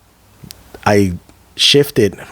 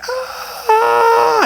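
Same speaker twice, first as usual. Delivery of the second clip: long, very high imitated scream; slow-motion scream. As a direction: stretch out a shrill slowed-down shriek. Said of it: "screaming in slow motion".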